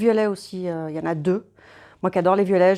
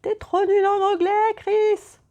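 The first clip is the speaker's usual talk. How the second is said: much higher, slow, mock-exasperated shout of viewers; excited viewers; 13 semitones higher, slower than usual. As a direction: play an impatient fan yelling at the screen. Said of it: "derrière l'écran en train de s'exciter".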